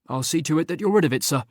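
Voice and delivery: brisk, cheerful assurance. A narrator's reading. Cheerful and quick. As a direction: Charles cheerfully reassuring his employer. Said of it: "said Charles cheerfully".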